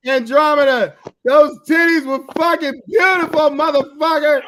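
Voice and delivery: high, loud gushing shout; real delighted yell of praise; excited shout. Real, not acted. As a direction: rave about it at full volume. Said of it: "gushing about the picture".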